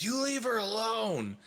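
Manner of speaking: high, loud, drawn-out yell; charging in with a high-pitched holler; heroic shout. Acted. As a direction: shout it high and long as you charge in to protect someone. Player voices character charging at attacker.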